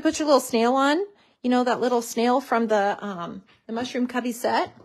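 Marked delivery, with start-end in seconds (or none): none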